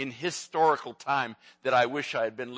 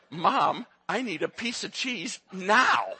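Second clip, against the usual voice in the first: put-on demanding toddler; 7 semitones higher, 4 dB louder, slower than usual. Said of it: "toddler demanding cheese".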